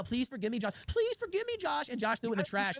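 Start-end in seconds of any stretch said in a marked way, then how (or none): 0.0-0.7 soft, whiny begging voice; mock groveling plea. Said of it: "begging email". 0.9-1.9 much higher, whining mock plea; high pleading whine. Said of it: "begging email".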